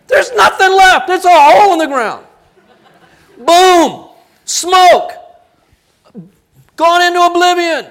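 loud, high, booming preacher cry; dramatic shout. Acted. Preacher to congregation, booming shout. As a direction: boom it out like the fire just fell.

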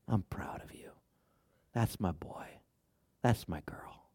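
soft, low, loving whisper; tender whisper. Pretend. God telling each listener he loves them, whispered tenderly. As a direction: whisper love to a child. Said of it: "you know what God said?".

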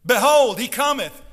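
loud, high proclamation; announcement. Acted. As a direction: proclaim it like a herald. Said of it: "this is the announcement as John gives it".

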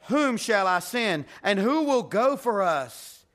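loud, slow, booming call from heaven; the Lord calling from heaven for a messenger, slow and booming. Retold or imitated. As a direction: proclaim it as God calling out. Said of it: "I heard the voice of the Lord saying".

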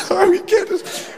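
high, flustered startled oh; embarrassed surprise. Retold or imitated. Couple caught crying by a watching classroom, flustered.

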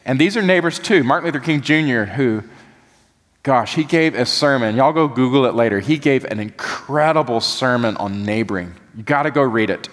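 usual speaking voice

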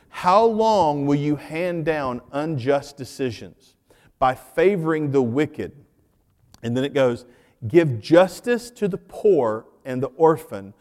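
slow, measured Bible reading; scripture reading. Acted. Pastor reading a psalm to the congregation, slow and measured. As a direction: read it out deliberately to the church.

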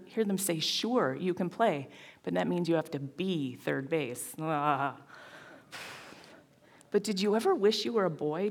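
earnest spoken performance piece; audition monologue. Acted. Actor performs her audition piece to the audience, earnest and building. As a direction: deliver it like a heartfelt stage monologue.

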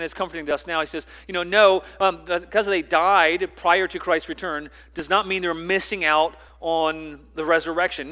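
fervent pulpit delivery; forceful preaching. Acted. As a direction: preach it out to the congregation.

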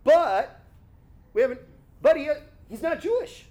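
high-pitched, halting protest; stammering, incredulous objector; mock objection. Acting out objection to Gentile believer.

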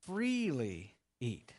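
"Freely" drawn out slowly, falling; slow, weighty stress on "Freely eat". Shown as a demonstration. Preacher reminding the congregation of God's words, slow and emphatic. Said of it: "Remember, God said".

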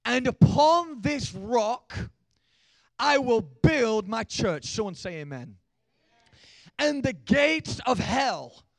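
slow, loud, high proclaiming shout; full-force preaching. Acted. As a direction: thunder it out slowly to the crowd.

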